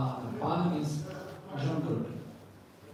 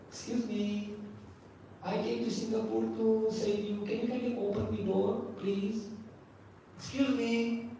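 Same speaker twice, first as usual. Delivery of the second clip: higher, softer pretend courteous plea; mock polite request; 5 semitones higher, 3 dB quieter than usual. Fireman pretending to ask at the door in Singapore, mock polite. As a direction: play an over-polite rescuer asking leave. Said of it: "Does he do like that?".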